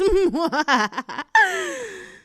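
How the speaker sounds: big amused burst of laughter; loud laugh. Real, not acted. Girl laughing at her own tease of the innocent kid.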